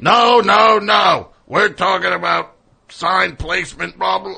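high, loud phone rant; angry yelling. Retold or imitated. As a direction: yell down the phone at him. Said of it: "he's, like, yelling about".

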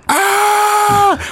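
high-pitched imitated coaster scream; mock scream of terror. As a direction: squeal like a scared rider on the drop. Sister screaming on coaster drop.

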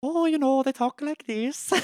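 high-pitched, badly faked Irish accent; attempted Irish accent. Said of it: "how to do an Irish accent".

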